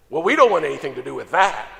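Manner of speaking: loud, quick mock indignation; imitated angry outburst. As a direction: imitate offended sisters blowing up. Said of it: "they all blew up at me".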